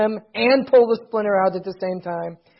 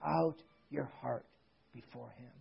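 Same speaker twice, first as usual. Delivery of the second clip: hushed solemn preaching; 7 semitones lower, 14 dB quieter than usual. Real, not acted. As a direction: close reverently with long pauses.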